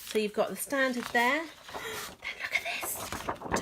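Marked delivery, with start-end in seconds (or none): 1.5-2.2 sharp delighted gasp; a quick breathless gasp of delight; excited gasp. Real, not acted. Excited reveal of paper. 2.2-3.6 slow breathy whisper of delight; excited whisper. Real, not acted. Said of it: "I'm whispering".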